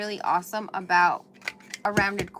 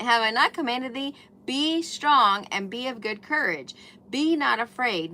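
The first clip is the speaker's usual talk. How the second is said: louder, raised proclamation of the verses; emphatic scripture; 3 semitones higher, 6 dB louder than usual. Acted. Repeating the commandment for her viewers, loud and forceful. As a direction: declare each line like a command.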